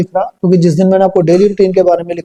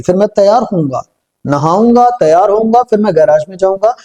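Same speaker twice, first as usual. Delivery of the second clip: normal talking voice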